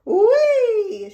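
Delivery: high, loud whee of delight; long high gleeful whee; gleeful shout. A narrator's reading. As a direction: shout for joy like a kid on a ride. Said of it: "she shouted".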